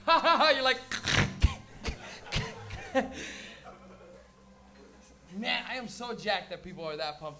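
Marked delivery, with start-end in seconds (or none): 0.1-0.8 loud, higher-pitched belly laugh, acted; big open laugh. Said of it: "you can't be like". 0.8-4.2 squeaky, voiceless wheeze of suppressed laughter; dying laughing but holding it in; stifled church laughter. Acted. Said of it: "church dying".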